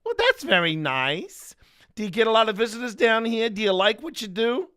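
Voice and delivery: high, loud cheerful chatter; chirpy Ted. Retold or imitated. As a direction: chat brightly to a demon. Ted chirps back at demon.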